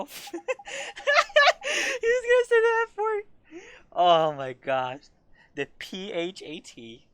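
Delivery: breaking into loud laughter at the joke; laughing hard. Real, not acted. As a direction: crack up mid-sentence. Player cracks up at joke.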